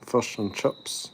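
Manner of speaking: put-on slow "Fish and chips" said as "fush and chups" in a New Zealand accent; jokingly saying "Fish and chips" in a slow New Zealand accent; mock Kiwi accent. Mocking New Zealand pronunciation.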